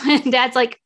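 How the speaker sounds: normal talking voice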